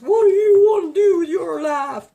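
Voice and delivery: yelled high and loud; shouted movie quote. Retold or imitated. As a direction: bellow it like a rock video. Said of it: "Isn't that off Animal House".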